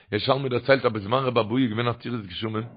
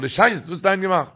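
raised, louder retold demand; insistent questioning; 7 semitones higher, 5 dB louder than usual. Questioner asking a second time, insistent. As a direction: press the question higher and louder.

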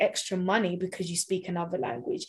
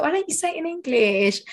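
teasing; 8 semitones higher, 4 dB louder than usual. Retold or imitated. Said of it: "I was like".

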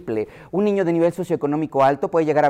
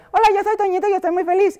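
put-on much higher childish little voice; small child voice; faster than usual. Child introducing himself after hearing baby talk, high childish voice. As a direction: squeak it out like a tiny child. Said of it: "el niño después va a hablar".

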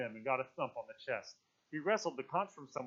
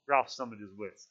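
ordinary delivery, nothing marked

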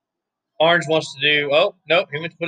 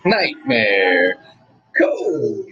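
ring announcer call; 3 semitones lower, much slower than usual. Retold or imitated. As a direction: belt the name out like an announcer. Echoing the ring announcer intro.

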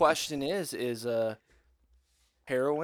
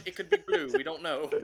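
mock bafflement; 10 semitones higher, 5 dB quieter than usual. Mock bewilderment while riffing.

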